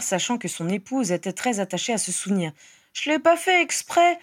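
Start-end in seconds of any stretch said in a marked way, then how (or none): 2.9-4.2 put-on high-pitched defensive little boy; child excusing himself. A narrator's reading. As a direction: protest your innocence as a small boy. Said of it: "s'exonéra le garçonnet". (petit garçon qui se défend)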